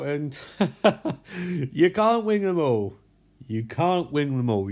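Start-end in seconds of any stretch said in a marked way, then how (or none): 0.4-1.7 a real little laugh breaking out; amused chuckle; short laugh. Real, not acted. Laughs at own joke. 1.7-3.0 said through a laugh, a bit higher; laughing resignation. Real, not acted.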